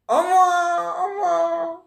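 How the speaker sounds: very high squeaky goodbye; shrill high falsetto farewell from the puppet; puppet's woman voice. Acted. Ventriloquist puppet Véronica saying goodbye in high falsetto. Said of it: "elle fait une voix de femme".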